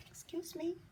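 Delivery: soft, high, timid-sounding request; quietly. A narrator's reading. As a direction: ask softly at the door. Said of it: "he said quietly".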